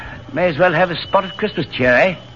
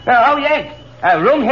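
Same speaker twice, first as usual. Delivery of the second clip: calling out; 8 semitones higher than usual. Acted. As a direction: call him over to the spare seat. Calling to the American.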